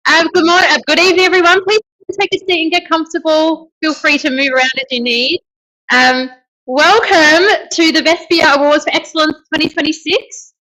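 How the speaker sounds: big projecting parade-ground voice; drill voice. Shown as a demonstration. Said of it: "some drill voices tonight".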